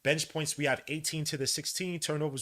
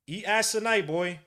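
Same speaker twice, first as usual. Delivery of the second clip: mocking taunt; 7 semitones higher, 7 dB louder than usual. Real, not acted. Taunting player on screen.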